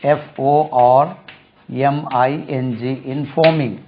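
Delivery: ordinary talk, nothing marked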